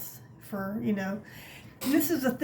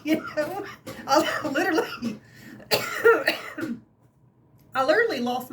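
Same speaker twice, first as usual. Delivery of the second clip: higher, laughing through the words; 5 semitones higher, 3 dB louder than usual. Real, not acted. Vlogger laughing about Ralph spooking her. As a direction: giggle at how scared she got.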